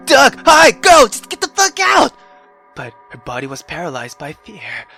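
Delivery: ordinary talk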